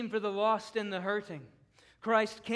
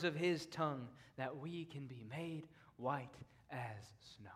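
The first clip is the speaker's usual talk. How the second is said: much softer and lower, words fading to breath; intimate hush; 5 semitones lower, 11 dB quieter than usual. Real, not acted. Preacher speaking softly to the unredeemed, hushed. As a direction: draw them in close and quiet.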